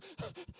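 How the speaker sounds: imitated tearful gasps; hitching sob-breaths; gasping breaths. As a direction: catch your breath before blurting it out. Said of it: "Pretty soon my wife goes".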